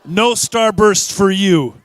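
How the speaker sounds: very loud, high, drawn-out refusal; mock stern proclamation. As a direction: boom it slowly to the whole room. Mock refusal called to the room.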